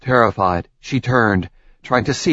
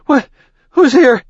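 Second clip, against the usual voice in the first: panicked shout; 7 dB louder than usual. Acted. Startled by touch in dark.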